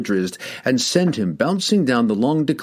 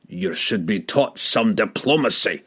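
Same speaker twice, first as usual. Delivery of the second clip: scolding. A narrator's reading. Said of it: "he scolded".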